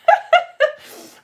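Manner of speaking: loud, high burst of laughter; high cackle. Real, not acted. Teacher amused by a viewer's mycelium line, loud cackle. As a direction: cackle helplessly at the comment.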